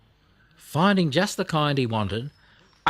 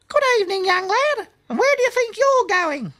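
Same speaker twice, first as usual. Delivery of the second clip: very high, louder squeaky insect voice; a tiny creature fussing grandly; chirpy cricket; 5 dB louder than usual. A narrator's reading. Cricket stopping Blinky on the path, very high and squeaky.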